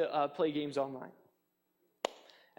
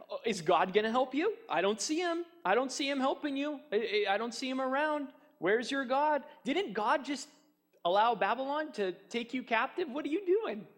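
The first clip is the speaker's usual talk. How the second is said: higher-pitched taunting questions; mocking; 8 semitones higher than usual. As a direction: needle them with scornful doubt. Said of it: "they're mocking them".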